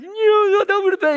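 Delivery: put-on very high, loud whiny child; mock complaining. Dad mimicking his crying son after a fall, high loud complaining. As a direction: imitate a kid's wailing complaint. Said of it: "like, complaining".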